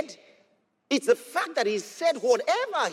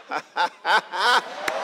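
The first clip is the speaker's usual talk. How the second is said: joyful laugh; 2 semitones lower than usual. Real, not acted.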